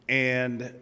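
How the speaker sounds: ordinary talk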